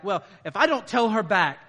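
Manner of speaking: loud, high, fast hammed-up indignation; mock angry spouse. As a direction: play the fired-up spouse ranting. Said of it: "There's this idea that, like".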